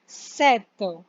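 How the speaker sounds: "सेत" drawn out slowly, no p; French sept. Shown as a demonstration. Teacher slowly modelling sept. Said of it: "पी नहीं बोलना है".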